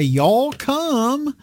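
high, light southern drawl on Y'all come; a first easy try at a southern Y'all come; southern accent. Pretend. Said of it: "a heavy southern accent".

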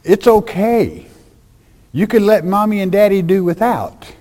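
high, sly mock of the elders' teaching; mocking the elders. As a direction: sneer it like a scheming elder. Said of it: "If it's korban".

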